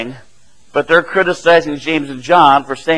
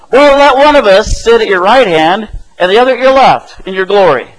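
louder, higher voice for pleading disciples; eager request; 5 semitones higher, 7 dB louder than usual. Retold or imitated. Disciples ask Jesus for the top seats, acted eagerly. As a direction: put the bold request loudly, acting it out.

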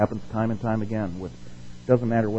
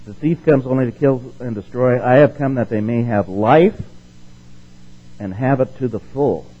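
scripture reading; 4 dB louder than usual. Acted. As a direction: read it out like a declaration. Reads scripture aloud.

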